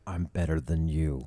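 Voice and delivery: low, slow, smug voice; smug superiority. Retold or imitated. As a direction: act superior, drawn out low. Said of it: "where you're like".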